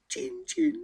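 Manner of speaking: very high squeaky "Csin, csin"; mouse squeak mimic. A narrator's reading. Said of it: "harsogta Petya".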